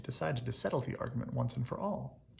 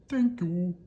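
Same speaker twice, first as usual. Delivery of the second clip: monster's booming thanks; 5 semitones higher, 10 dB louder than usual. Acted.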